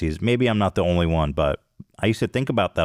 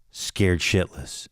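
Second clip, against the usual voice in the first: usual speaking voice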